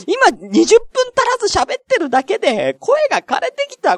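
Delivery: high, strained wail as the voice gives out; worn-out high lament; voice going hoarse. Real, not acted. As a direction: complain through a tiring voice. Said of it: "声も枯れてきた".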